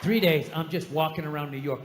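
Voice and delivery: ordinary talk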